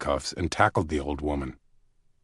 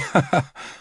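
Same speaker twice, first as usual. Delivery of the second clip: laugh; 9 semitones higher than usual. Acted. Caleb laughed.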